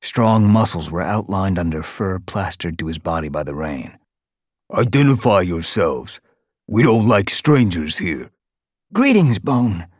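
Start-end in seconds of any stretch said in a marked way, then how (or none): 4.7-8.3 louder, gruff demand; a bruiser warning off outsiders; hostile guard Bone. A narrator's reading. Guard Bone threatens strangers. 8.9-9.9 high, even greeting; steady greeting. A narrator's reading. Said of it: "meowed steadily".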